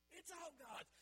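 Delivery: rising preacher's cry; high, loud proclamation. Shown as a demonstration.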